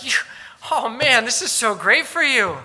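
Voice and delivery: loud, high mock congratulations; sarcastic. Paul congratulating the Corinthians, heavily sarcastic. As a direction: gush with fake admiration. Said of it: "Do you hear the sarcasm?".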